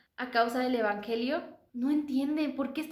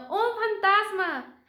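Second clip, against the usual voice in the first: higher, slow frightened cry; 9 semitones higher, 5 dB louder, much slower than usual. Acted. Disciples at sea crying out in fear. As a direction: cry out as if seeing a ghost. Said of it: "se turbaron diciendo".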